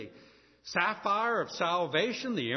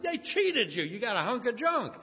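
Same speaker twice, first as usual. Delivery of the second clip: lightly voiced mother setting the kid straight; a hint of an exasperated mom; scolding mama; 5 semitones higher than usual. Mama scolds the child's trade.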